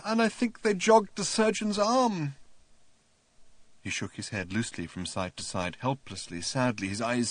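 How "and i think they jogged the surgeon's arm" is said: crying. A narrator's reading. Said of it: "He began to cry again".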